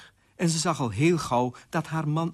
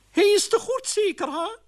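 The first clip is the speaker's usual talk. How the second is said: very high-pitched character voice; old aunt figure; 5 dB louder than usual. Acted. Aunt Oena welcomes the queen, high character voice. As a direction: pipe it up in a high put-on voice. Aunt Oena's knowing question.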